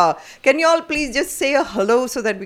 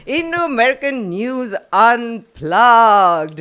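announcer voice; 3 semitones higher, 6 dB louder, much slower than usual. Acted. Announcing the show name.

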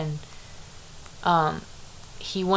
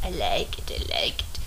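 whispering; 8 semitones lower, 6 dB quieter than usual. Acted. Playful whispered reply.

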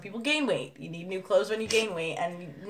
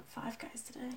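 whisper; 4 semitones higher, 20 dB quieter than usual. Real, not acted. Aside about cheeseburger smell.

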